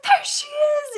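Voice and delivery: very high, loud squeal of recognition; hammed-up fanboy cry; imitating delighted shout. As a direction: shriek it as if spotting a favorite.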